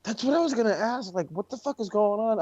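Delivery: high, baffled what the fuck; incredulous outburst. Real, not acted. Reacting to the wrestler's new look, incredulous.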